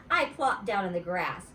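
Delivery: ordinary delivery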